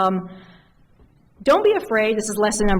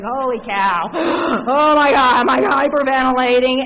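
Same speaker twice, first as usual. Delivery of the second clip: loud, higher breathless panic; mock gasping panic; 5 semitones higher, 7 dB louder than usual. Young lawyer freaking out, loud gasping panic. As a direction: act out nearly hyperventilating. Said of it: "I was just like".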